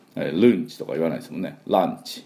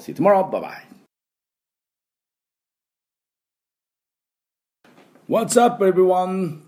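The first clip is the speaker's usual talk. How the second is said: bright greeting; 12 semitones higher, 4 dB louder, slower than usual. Real, not acted. Cheery sign-off and intro.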